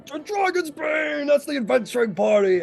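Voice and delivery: high, excited shout; excited proclamation. Acted. Host announces the adventuring party, high excited shout.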